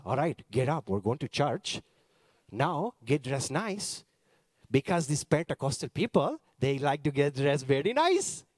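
put-on Indian accent, firm parental orders; dad's Indian accent. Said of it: "I'll do it in the accent".

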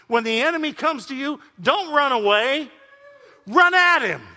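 high, loud building shout; full-force preaching. Acted. Preacher urging the congregation to charge the enemy. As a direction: build to a shout on run at him.

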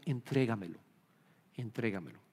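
low, hushed and soft; gentle divine invitation. Acted. God asking the believer to hand it over, softly. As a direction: drop right down, softly coaxing. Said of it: "Él solo te está diciendo".

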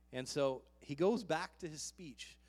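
usual speaking voice

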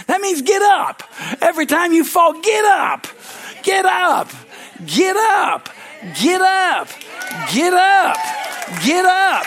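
high, loud repeated shouts; shouted get up. Real, not acted. Preacher rousing the congregation to get up, shouting. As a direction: hammer get up at them like a rally cry.

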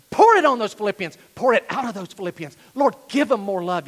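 loud plea, easing from its peak; impassioned prayer. Acted. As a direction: keep begging with force. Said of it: "picture Paul on his knees".

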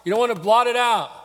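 high, loud mock pulpit warning; warning preacher. Preacher imitating others who use the verse negatively, high scolding warning. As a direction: imitate preachers threatening their flock. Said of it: "used that to kind of in a negative way".

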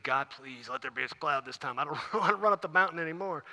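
low, soft muttered prayer; servant's quiet grumbling thought; weary private plea. Acted. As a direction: plead under your breath, half laughing. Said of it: "I'm thinking".

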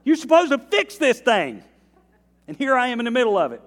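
high, loud, exasperated protest with a laugh; complaining to God. Retold or imitated. Believer complaining to God, mock exasperated. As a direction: whine at God, half laughing. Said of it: "you ever said that to God?".

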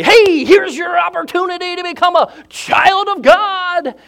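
loud, high, hammed-up shouted invitation; mock hype shout; over-excited shout. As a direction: yell it like a pushy announcer. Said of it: "get all excited and say".

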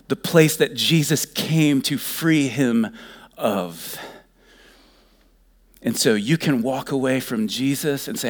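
usual speaking voice

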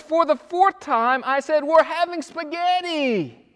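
a fed-up wife who has answered three times already; exasperated wife. Retold or imitated. Wife exasperated, repeating her answer.

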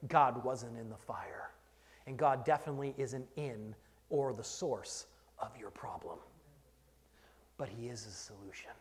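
hushed, unvoiced whisper; a secret shared with the congregation. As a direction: lean in and whisper it. Drops to a hush mid-sermon.

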